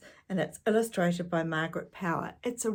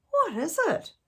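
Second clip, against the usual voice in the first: curious little brother; 11 semitones higher, 4 dB louder than usual. A narrator's reading. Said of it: "asked Tom".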